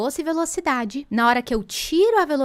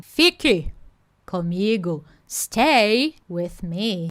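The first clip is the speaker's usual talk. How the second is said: slow spoken command; 3 semitones lower, much slower than usual. Shown as a demonstration. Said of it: "te dando uma ordem".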